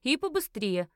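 mildly impatient prod. A narrator's reading. Narrator urging the fat man to hand over his coat, impatient. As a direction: hurry him along with a touch of irritation. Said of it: "нетерпеливо повторила я".

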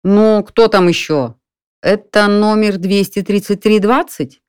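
lightly voiced, brusque and hurried in tone; impatient man on the phone, mildly voiced. A narrator's reading. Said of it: "мужской голос нетерпеливо сказал".

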